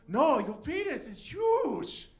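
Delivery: loud, slow, high bad Chinese accent; bad Chinese accent shout. [name] correcting the comic, hammed-up Chinese accent shout. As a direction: shout it in a mock Chinese accent, stretching each word. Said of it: "make a really bad accent".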